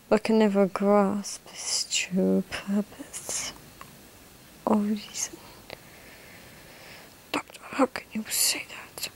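whisper. Acted.

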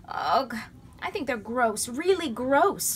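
high, loud, drawn-out disgust; girl exclaiming at the snakes in disgust; disgusted exclamation. A narrator's reading. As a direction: recoil from the snakes. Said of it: "Lindy exclaimed".

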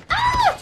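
shrill startled shriek, very high and loud; a sudden high scream. Real, not acted. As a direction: yelp in real fright.

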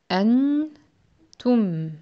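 أنتم said slowly, syllable by syllable; slow syllable reading. Shown as a demonstration. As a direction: draw out أنتم slowly and carefully. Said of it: "lisons ce mot". (أنتم lu lentement)

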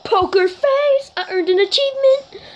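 very high, drawn-out victory shriek; really freaking out. Real, not acted. Player winning the shootout, high ecstatic screaming. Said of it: "I'm really freaking out".

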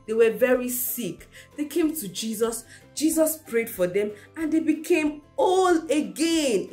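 usual speaking voice

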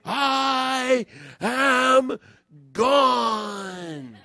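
loud, high, drawn-out proclamation; big stretched mock shout; yelling. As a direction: imitate her hollering it at full voice. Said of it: "like, she's yelling".

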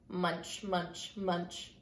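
low, soft, separate munches; quiet munching. A narrator's reading. Narrator closing the story on quiet munching, low and hushed.

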